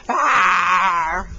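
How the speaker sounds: loud playful rawr; bear roar. Shown as a demonstration. Showing the toddler a bear roar, loud rawr. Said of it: "Can you say rawr?".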